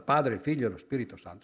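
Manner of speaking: rattled off quickly; quick listing. Real, not acted. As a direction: run through the names fast. (elenco veloce)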